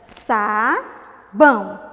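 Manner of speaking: SABÃO very slow and loud, "saa... bão"; syllable by syllable. Shown as a demonstration. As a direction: stretch and separate both syllables of Sabão. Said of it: "duas sílabas". (Sabão bem devagar, sílaba por sílaba)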